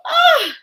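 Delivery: high, loud grossed-out ugh; disgusted ugh. Acted. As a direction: groan as if bitten by mosquitoes. Mosquitoes making them itchy.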